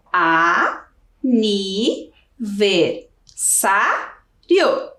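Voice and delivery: each syllable split off with a pause. Shown as a demonstration. Teacher splitting the word for pupils, syllable by syllable. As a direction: count out the syllables slowly. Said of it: "Quais são as sílabas da palavra aniversário?".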